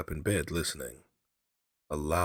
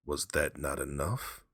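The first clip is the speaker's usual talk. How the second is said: usual speaking voice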